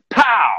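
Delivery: imitated gunshot. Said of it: "he goes".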